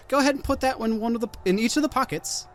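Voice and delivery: high, quick hag instructions. Acted. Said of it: "She says".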